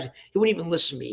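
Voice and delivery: normal talking voice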